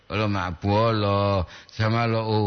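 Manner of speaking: usual speaking voice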